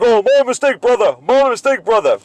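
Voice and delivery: high, loud, put-on apology; mock apologetic attendant. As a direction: imitate a guy falling over himself to apologize. Imitates the apologizing gas attendant.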